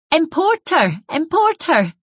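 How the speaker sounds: put-on high, excited little-child voice saying "Importer" with a T for the ST; delighted child's shout. A narrator's reading. Small boy echoes his parents' new word, shouting in delight. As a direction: pipe it up like a gleeful toddler. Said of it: "Charlie shouted out in delight".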